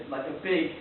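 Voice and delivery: ordinary delivery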